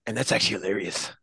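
low, quick deadpan Chris Evans; a lightly voiced Chris Evans, low and quick; Chris Evans impression. Doing his Chris Evans line while looking at his phone, low deadpan. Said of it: "I do the Chris Evans one".

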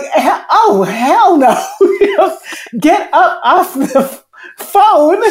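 scolding mother voice. Retold or imitated. Inner voice like her mother scolding her, loud and high. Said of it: "That's exactly what the voice was saying".

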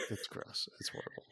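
hushed, disgusted aside; whispered disgust. Real, not acted. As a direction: whisper it like it's revolting. Disgust at Skyline chili.

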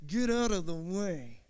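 slow, firm dad warning; slow it down like a dad who means it; stern dad. Retold or imitated. Preacher voicing an angry dad clearing the way, slow and firm. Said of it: "But when dad gets mad".

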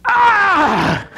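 high, sudden shriek of "Ah"; startled demon yelp; demon's cry. Shown as a demonstration. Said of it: "It would mean".

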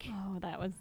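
ordinary delivery

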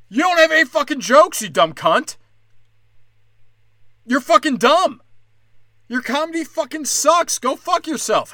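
high, loud furious outburst; angry yelling. Real, not acted. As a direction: tear into her, all fury. Yelling insults at the comic.

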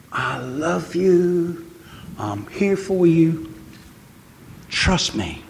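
slow, gentle words of love spoken for God; tender voice of God. Acted. Preacher voicing God's love to the congregation, slow and gentle. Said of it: "He's calling you to say".

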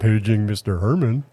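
imitating a deep-voiced movie page; deep dubbed voiceover. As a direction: put on a dubbed-over announcer voice, amused. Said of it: "that really deep voice".

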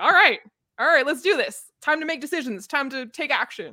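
high, rallying take-charge voice; pumped-up call to action, pitched high; mock decisive resolve. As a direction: play a reluctant hero suddenly stepping up. Voicing him snapping into decisive action.

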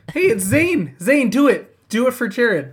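loud, high cheer urging him on; egging on. Real, not acted. Friend loudly urging Zane to do the bit.

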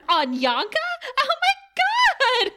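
very high, drawn-out excited squeal; delighted demon squeal. Retold or imitated. Demon recognises her old friend Anya, overjoyed high squeal. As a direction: drop the menace and shriek with mock delight. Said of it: "this demon's voice changes immediately".

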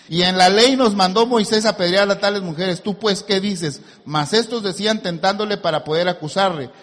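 continuous, emphatic pulpit reading; scripture reading. Acted. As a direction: read the passage straight through, projecting. Rereads the passage continuously.

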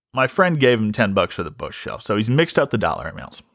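ordinary talk, nothing marked

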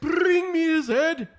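mock warlord command. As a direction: bark "Bring me his head" like a cartoon king.

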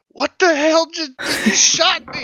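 very high, incredulous exclamation; retold shocked outcry. Player retelling the moment they got shot, shocked outcry. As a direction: act out a high-pitched outraged yelp.

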